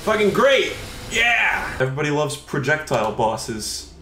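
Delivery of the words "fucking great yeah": high, mock-delighted exclamations; exaggerated fake enthusiasm, pitched up; sarcastic cheering. Real, not acted. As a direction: cheer bitterly at more bad luck. Mock cheering an annoying boss.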